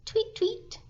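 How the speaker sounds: high little chirp; a baby bird's high tweet for the listening children; baby robin tweet. A narrator's reading.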